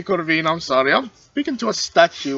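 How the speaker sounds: ordinary talk, nothing marked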